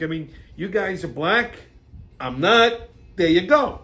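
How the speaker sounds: ordinary talk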